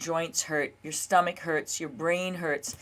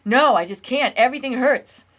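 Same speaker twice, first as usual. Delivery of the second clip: louder, higher desperate protest; panicking nervous system; 3 semitones higher, 8 dB louder than usual. Retold or imitated. Her nerves refusing another fifty miles, loud panicked protest. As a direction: let the panic push up and out. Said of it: "the nervous system goes".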